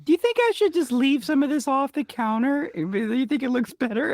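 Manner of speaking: high-pitched mock husband asking; imitating husband. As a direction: put on a high voice for the husband's question. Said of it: "he was like".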